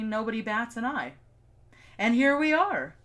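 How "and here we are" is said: loud, emphatic sign-off; dramatic announcement. Acted. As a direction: proclaim it like a finale. Dramatic closing line.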